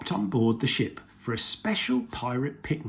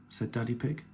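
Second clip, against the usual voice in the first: plain reading voice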